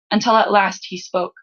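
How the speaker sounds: ordinary delivery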